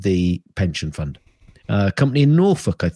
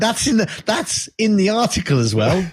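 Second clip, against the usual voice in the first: laughing; 9 semitones higher than usual. Real, not acted. Laughing aside, high pitch.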